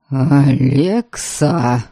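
low, slow, spat-out man's voice; spat through teeth. A narrator's reading. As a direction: spit the name out through the teeth. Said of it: "сквозь зубы выплюнул Норберг".

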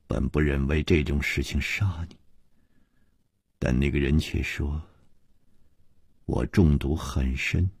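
low, soft, measured confession; subdued and deliberate, pitched well down; speaking slowly. A narrator's reading. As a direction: explain it quietly, with pauses between phrases. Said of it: "缓缓地说".

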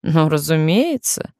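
ordinary delivery, nothing marked

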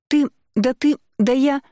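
too furious to finish words; spluttering with rage. A narrator's reading. Enraged sister sputters down the phone, choking with rage. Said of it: "Она задыхается от злости".

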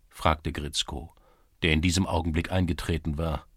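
usual speaking voice

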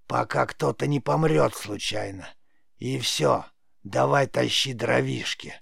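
creaky, scornful crone voice; sneering old witch. A narrator's reading. Witch dismisses the boy's praise of his gift, sneering rasp. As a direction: rasp it out with a wicked edge. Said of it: "старуха зло усмехнулась".

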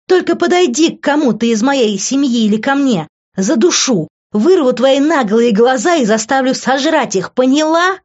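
high-pitched furious tirade; screaming threats. A narrator's reading. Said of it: "закричала она".

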